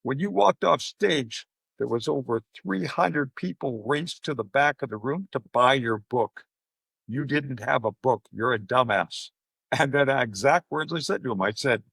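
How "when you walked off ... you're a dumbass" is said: slow explaining. Retold or imitated. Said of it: "And he goes".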